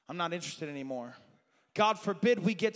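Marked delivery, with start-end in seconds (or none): 0.1-1.1 imitated cold refusal. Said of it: "And he says".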